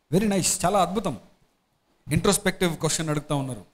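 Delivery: ordinary talk, nothing marked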